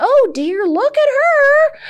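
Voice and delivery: put-on granny watching the girl in the waves; panicked granny. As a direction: cry out in rising panic.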